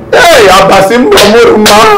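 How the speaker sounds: high, loud cry of despair; distraught wail. Acted. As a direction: wail out in panic at the loss. Wailing over own lost money.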